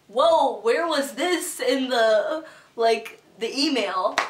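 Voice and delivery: loud, slow, stunned whoa; 1 shocked reaction. Retold or imitated. Reacting to surprise posing at the model interview, stunned and slow. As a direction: drag it out in disbelief.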